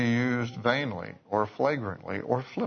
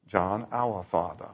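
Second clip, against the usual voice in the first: put-on elderly Southern lady's accent, r's dropped; imitated Southern accent; 3 semitones lower than usual. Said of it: "That Southern accent".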